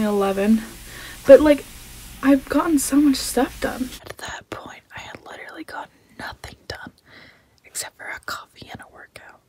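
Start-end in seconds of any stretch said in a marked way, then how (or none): 4.0-9.4 hushed confessional aside; quick whispered aside; whispering. Real, not acted. Whispered aside after the upbeat talk.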